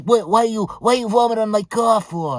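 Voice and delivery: put-on high, loud, angry Italian accent; mock Italian accent. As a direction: imitate an outraged Italian car owner. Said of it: "fat Italian guy".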